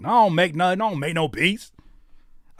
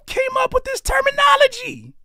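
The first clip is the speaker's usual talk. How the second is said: incredulous squeal; 4 dB louder than usual. Real, not acted.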